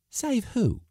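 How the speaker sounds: high woman's voice; questioning Josefa. A narrator's reading. Josefa challenging Givens, high questioning. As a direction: pitch it well up, demanding to know.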